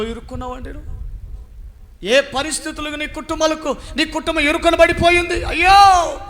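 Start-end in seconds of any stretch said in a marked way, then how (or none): 2.1-6.2 climbs higher and louder into a loud cry of ayyo; rising lament. Acted. Preacher pitying trapped families, rising loud lament. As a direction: wail the family's trouble upward.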